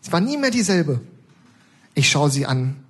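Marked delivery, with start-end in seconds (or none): 0.0-1.0 put-on higher, serious old lady voice; gravely pitched-up granny; earnest old woman. Old woman telling the narrator solemnly, higher old lady voice. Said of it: "sagt sie ernst".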